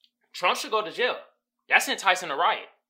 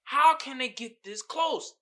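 disbelief; 8 semitones higher than usual. Retold or imitated. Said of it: "I was like".

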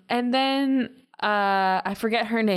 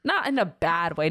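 normal talking voice

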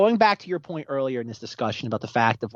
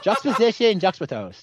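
"juxtapose" said through laughter; laughing through the word; 6 semitones higher than usual. Real, not acted. Host laughing as he finally gets the word out. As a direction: giggle out "juxtapose" finally right. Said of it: "I can't say it".

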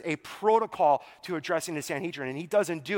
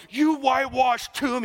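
acted outburst, higher and louder; hurled insult; 5 semitones higher, 5 dB louder than usual. Preacher as Paul lashing out at the high priest, acted angry outburst. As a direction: throw it out like a quick-tempered insult.

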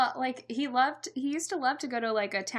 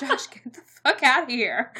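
mock outburst; 4 semitones higher, 9 dB louder than usual. Mock outburst while retelling scene.

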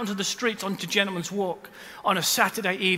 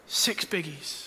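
soft; 6 semitones lower, 8 dB quieter, slower than usual. Shown as a demonstration. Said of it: "but in forty-three years".

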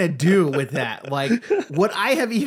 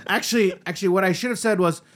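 ordinary talk

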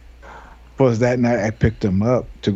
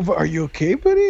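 concerned bystanders; 8 semitones higher than usual. Retold or imitated. Voicing bystanders checking on him.

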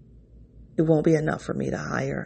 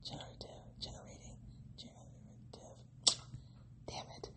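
frustrated whispered muttering; whispered to self; 24 dB quieter than usual. Real, not acted. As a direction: whisper the fumble under your breath. Fumbling a word, muttering.

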